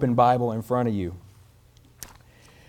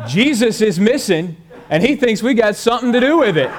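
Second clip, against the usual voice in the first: louder, higher, laughing as he delivers it; punchline through laughter; 7 semitones higher, 7 dB louder than usual. Acted. Preacher to congregation, finishing the joke laughing. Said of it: "The other boy says".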